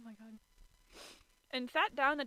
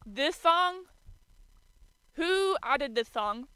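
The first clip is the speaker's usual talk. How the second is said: indignant; 4 semitones higher, 8 dB louder, slower than usual. Real, not acted. Outraged at the song choice.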